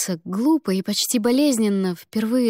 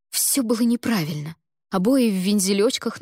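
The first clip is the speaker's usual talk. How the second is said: plain reading voice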